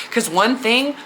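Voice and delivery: normal talking voice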